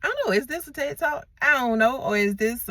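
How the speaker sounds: high, quick amused aside; playful aside. Real, not acted. Laughing off his rant to viewers, voice pitched high.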